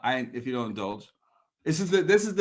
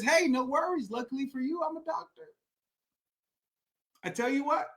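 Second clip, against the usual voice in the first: soft, higher-voiced retold doctor; breezy reassurance; 4 semitones higher, 5 dB quieter than usual. Doctor to man in the hole, soft casual reassurance. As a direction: reassure lightly, with no real concern.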